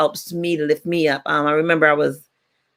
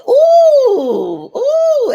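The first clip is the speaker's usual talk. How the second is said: cooing cousin; 7 dB louder than usual. Retold or imitated. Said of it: "And she said".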